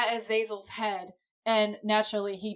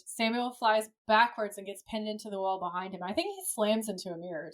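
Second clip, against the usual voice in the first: usual speaking voice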